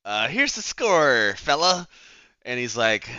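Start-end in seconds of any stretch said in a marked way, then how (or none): 0.0-1.9 slow, higher, louder hard-boiled gangster drawl; 1930s tough guy. Pretend. Detective squeezing Frankie, slower and hammier noir gangster. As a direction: really ham up the old-movie heavy. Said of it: "I don't know why I'm talking like this".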